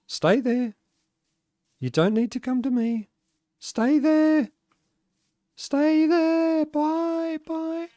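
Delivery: very high, cooing "stay" voice; coaxing singsong. Real, not acted. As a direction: coax a pet sweetly in a high voice. Sending a companion off, coaxing it.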